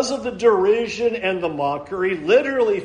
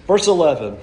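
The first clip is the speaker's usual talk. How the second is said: ordinary talk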